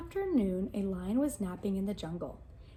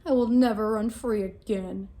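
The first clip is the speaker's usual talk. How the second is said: louder, despairing lion; trapped lion, loud and despairing; 8 dB louder than usual. Acted. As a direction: roar out the hopelessness. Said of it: "cried the lion".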